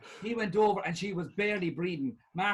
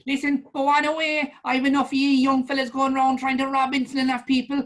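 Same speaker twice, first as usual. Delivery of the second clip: put-on higher, louder furious old woman; angry old lady shout; 8 semitones higher, 9 dB louder than usual. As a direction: chase the young thief off, yelling. Old lady shouting Tony away.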